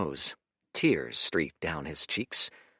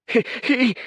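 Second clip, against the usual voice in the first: choking, breaking off high; strangled; 9 dB louder than usual. Acted. Grieving son choking up mid-shout, strangled. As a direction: choke on the words. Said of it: "A strangled noise".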